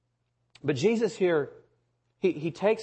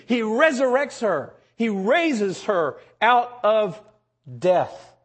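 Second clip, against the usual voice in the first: higher and louder, building with amazement; preaching at full force; 7 semitones higher, 6 dB louder than usual. Acted. Preacher exulting over the girl raised from death, full-force preaching. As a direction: proclaim the miracle to the congregation.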